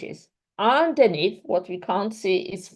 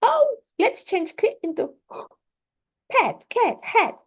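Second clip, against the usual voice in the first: put-on high, bright demo voice for a child; teacher demonstrating to a student, high playful voice; 12 semitones higher than usual. Shown as a demonstration. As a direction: play the teacher setting a sound task, pitched well up. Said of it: "And I say".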